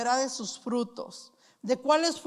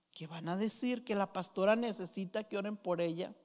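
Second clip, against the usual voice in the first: hushed mock gossip; 5 semitones lower, 8 dB quieter, faster than usual. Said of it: "imagined gossip about the pastor".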